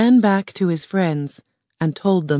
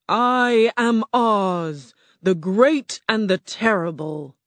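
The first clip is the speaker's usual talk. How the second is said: slow, booming beast voice of Oz; grand slow roar from a huge animal; 4 semitones higher, slower than usual. Acted. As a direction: roar it out slowly like a great beast. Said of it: "the ball of fire roared".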